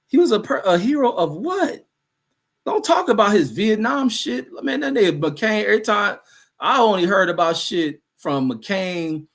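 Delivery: high, incredulous challenge; indignant disbelief. Real, not acted. Streamer rejecting a viewer's praise of McCain, indignant. Scoffs at commenter's hero claim.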